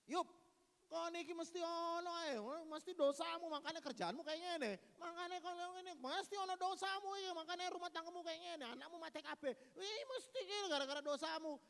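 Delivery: high, loud nagging accusation; accusing friend. Retold or imitated. Friends scolding Job that his sins caused it. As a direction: wag a finger at him.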